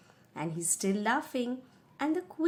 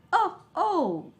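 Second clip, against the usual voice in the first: alarmed cry; 8 semitones higher, 5 dB louder than usual. Acted. Teacher reacts to witch slipping.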